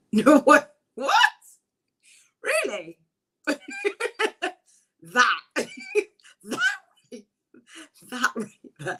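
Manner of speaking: laughing high squeals of disbelief; thrilled surprise. Real, not acted. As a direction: caught off guard, giggling with delight. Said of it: "It makes me really emotional".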